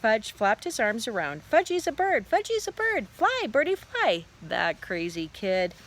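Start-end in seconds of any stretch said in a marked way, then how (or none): 1.5-4.2 put-on high, gleeful little-kid voice; excited toddler. A narrator's reading. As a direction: crow like a small child pretending to fly. Toddler pretends to be a bird.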